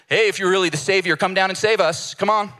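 mocking taunt. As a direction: taunt the dying man, mocking. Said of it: "starts taunting Jesus".

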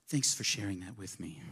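mock gracious. Pastor pretends gracious phone reply.

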